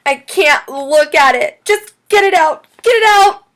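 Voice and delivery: high, loud panicked scream. A narrator's reading. Chloe begs teacher to remove the worm, screaming. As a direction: build to a frantic shriek. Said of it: "she screamed".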